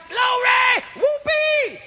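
very high, whooping shout; hollered rejoicing. Retold or imitated. Preacher re-enacting his sidewalk celebration, hollered rejoicing. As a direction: holler it out in ecstatic joy. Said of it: "I hollered, I screamed".

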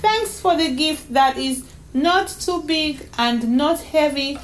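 put-on high child's voice; child proudly listing her clues; cheeky little girl. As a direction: thank Mommy like a small girl. Voices young Donna May in story.

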